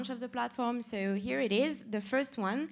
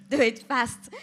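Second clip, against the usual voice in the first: laughing urge; 8 dB louder than usual. Real, not acted. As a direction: giggle then push, louder. Laughs while urging staff.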